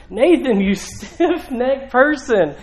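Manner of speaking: stern rebuke. Retold or imitated. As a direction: scold by name, stretching out the insult. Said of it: "I don't think he's giggling".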